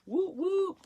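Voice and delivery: high little cheer; excited whoop. Real, not acted. Cheering over a hat and cowl idea, high excited whoop. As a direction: whoop it up, thrilled.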